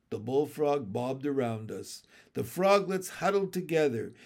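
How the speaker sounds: usual speaking voice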